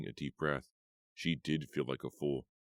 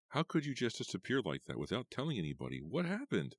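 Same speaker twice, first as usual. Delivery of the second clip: upset questioning; 8 semitones higher than usual. A narrator's reading. Eva confronting vanished friend.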